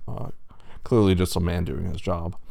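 usual speaking voice

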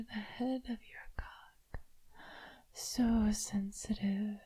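soft unvoiced whisper. Mistress whispering to her toy, teasing. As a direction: breathe it close to the ear.

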